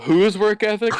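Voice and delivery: high, loud, incredulous question; indignant challenge. Retold or imitated. Student challenged on work ethic by teacher, indignant challenge. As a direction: throw it back in mock outrage.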